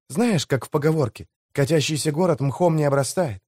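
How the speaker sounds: usual speaking voice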